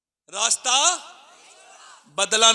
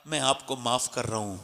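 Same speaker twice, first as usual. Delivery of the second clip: gentle forgiveness; 8 semitones lower, 11 dB quieter than usual. Retold or imitated. Imam forgives his abuser.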